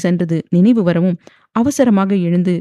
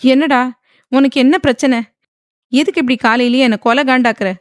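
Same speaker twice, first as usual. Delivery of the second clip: higher, louder angry flare-up; irritated outburst; 5 semitones higher, 6 dB louder than usual. A narrator's reading. Wife flaring up at her husband in the morning, irritated outburst. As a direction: lash out in irritation. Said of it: "என்று எகிறினாள்".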